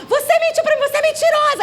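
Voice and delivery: high-pitched tantrum accusation; imitated child yelling at mother; child's angry shout. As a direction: fling it out high, like a child in crisis. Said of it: "ele gritou".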